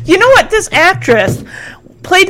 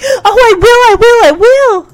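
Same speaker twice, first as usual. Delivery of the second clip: gushing; 8 semitones higher, 3 dB louder than usual. Retold or imitated. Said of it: "gushing in front of him".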